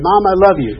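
retold insistent affection; loud declaration. Bright declaration to reluctant mother.

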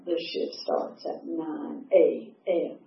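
low, soft, slow and clipped reminder; a quiet stern boss correcting her; stern supervisor. Retold or imitated. Helen coldly reminding a late volunteer, stern and slow.

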